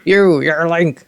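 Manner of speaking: mock outraged guard; outraged recognition. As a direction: spit out his name in shock.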